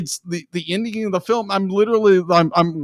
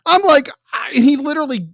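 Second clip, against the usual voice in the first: outraged disbelief; 9 semitones higher, 3 dB louder than usual. Real, not acted. Outraged retelling of the attack scene.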